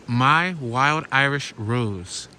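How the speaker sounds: reading the sign out slowly. Real, not acted. Vlogger across the street, slowly reading a pub sign. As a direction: draw out each word of the sign. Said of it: "it says".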